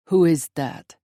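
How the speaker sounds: put-on loud, puzzled Russian-accented question. A narrator's reading. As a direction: ask firmly as the Russian intruder. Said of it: "The Russian accent".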